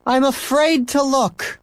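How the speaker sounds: slow, high mock-dread mother; a hint of weary mother; wary mother. A narrator's reading. Mother in kitchen to son, slow and wary.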